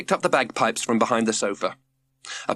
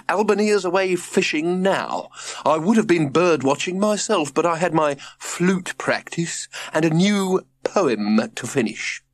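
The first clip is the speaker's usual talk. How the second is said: prim father voice; 7 semitones higher than usual. Acted. George voiced.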